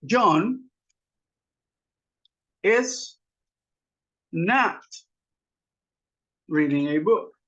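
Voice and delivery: long pauses between single words. Shown as a demonstration. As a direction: dictate it one word at a time. Builds negation word by word. (palabra por palabra, con pausas largas)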